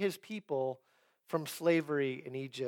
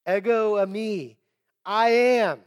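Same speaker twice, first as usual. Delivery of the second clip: loud, raised declaration; powerful, emphatic; 4 semitones higher, 8 dB louder than usual. Acted. Preacher voicing Jesus identifying himself on the waves, powerful and emphatic. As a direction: proclaim it with force and weight. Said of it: "He says it with power. He says it with emphasis".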